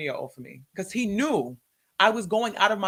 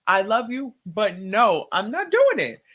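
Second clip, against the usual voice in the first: emphatic refusal; 2 semitones higher, 7 dB louder, slower than usual. Retold or imitated. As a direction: plant your feet and refuse God. Acts out refusing God.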